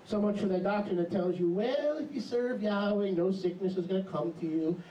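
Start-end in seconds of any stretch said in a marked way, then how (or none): none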